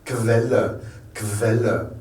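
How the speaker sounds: "Quelle" as KVEL-le. Shown as a demonstration. Teacher showing what Q-U becomes, KV sound. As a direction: sound K then V to start "Quelle". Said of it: "ça devient un K-V".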